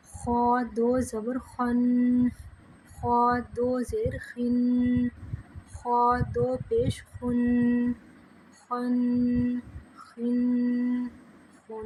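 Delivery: very slow chant with خا heavy and rasped; full heavy kha. Shown as a demonstration. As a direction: rasp خا full and thick. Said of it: "پر کر کے پڑھیں گے". (خا کو پُر کر کے پڑھنا)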